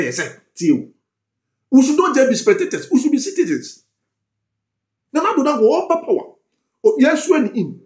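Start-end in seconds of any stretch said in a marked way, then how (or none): 1.7-7.8 high, fired-up plea to fellow citizens; rally them, voice lifted high; impassioned rant. Real, not acted. Passionate political rant.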